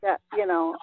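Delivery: normal talking voice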